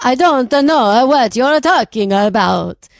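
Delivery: slow, drawn-out mock Italian accent; Italian vampire accent. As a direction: stretch every word like a bad Italian vampire. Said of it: "I think I just did an Italian vampire".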